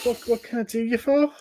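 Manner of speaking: high-pitched put-on Scouse; Lister's Scouse voice. Stranger asks the adventurer his business, Scouse. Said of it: "gonna go for a Lister voice".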